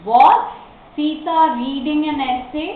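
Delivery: emphatic WAS, pause, then the question; model reading. Shown as a demonstration. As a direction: punch "Was" and lift the question. Teacher models a yes-no question.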